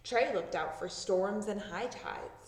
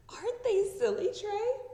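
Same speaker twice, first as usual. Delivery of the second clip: playful tease; 12 semitones higher, 4 dB louder than usual. Acted. Girl teasing strangers to her dog.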